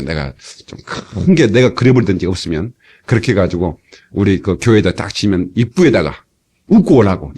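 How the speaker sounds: ordinary talk, nothing marked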